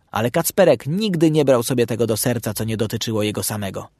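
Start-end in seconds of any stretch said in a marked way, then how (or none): none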